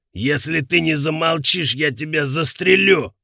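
high, loud menacing yell; threatening shout. Acted. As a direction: bark the threat at her. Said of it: "крикнул он".

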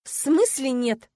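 slow, high, loud indignation; indignant. A narrator's reading. Heroine challenging her fiancé's refusal, indignant. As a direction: drag the question out in outrage. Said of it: "возмутилась я".

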